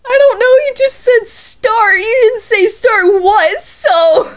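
high, loud flustered protest; flustered shouting. Real, not acted. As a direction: yell it out in embarrassment, slowly. Flustered outburst at friend.

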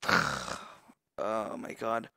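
low, soft, very slow defeated sigh; real deflated sigh; weary sigh. Real, not acted. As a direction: sag with exhaustion. Sighing after loss.

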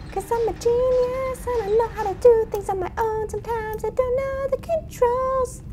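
mock-boasting genius in a squeaky high voice, slowing down; silly high voice. Gamer to her viewers, a squeaky mock-genius voice. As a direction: play a smug little genius, high and silly.

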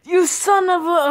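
high, loud protest; angry outburst. Real, not acted. Gamer cursing after dying in the game, angry high shout. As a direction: yell in outraged disbelief. Killed again, curses the killer.

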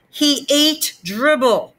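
slow, high, every word pressed; insist, dragging it out. A narrator's reading. Peter insisting to mom, slow and high. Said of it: "I insisted".

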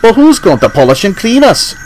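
high, pleading cry from Duncan; upset cry. A narrator's reading. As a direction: Duncan cries out in dismay at losing Nancy. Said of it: "cried Duncan".

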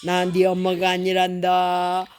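a hint of a big cat turning the baby away; tiger voice. Mother reading the tiger's line to her child, lightly voiced. Tiger in picture book speaks.

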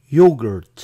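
"yogurt" as YO-gert, first syllable punched loud; correct English pronunciation. Shown as a demonstration. Teacher gives the true choice, "yogurt" as loud YO-gert. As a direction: stress the YO in "yogurt", then a falling "gert". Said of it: "Se dice yogurt".

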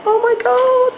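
very high-pitched, thrilled disbelief; starstruck amazement. Real, not acted. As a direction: squeal it in awe. Thrilled he shook President's hand.

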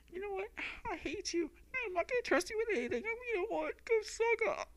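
high, quick bitter rant; angry girl voice. Acted. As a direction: spit it out like a hurt girl. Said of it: "let me try this".

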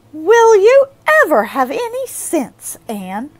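high, loud exasperated scold; exasperated groan. A narrator's reading. Marilla groaning at Anne's foolishness. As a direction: despair of her. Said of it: "groaned Marilla".

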